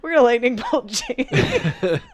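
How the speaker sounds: slow, laughing through the words; giggling as he says it. Real, not acted. Laughing while commentating.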